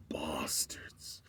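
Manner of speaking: low whispered curse. Retold or imitated. Cafe guy cursing the FBI, whispered under breath.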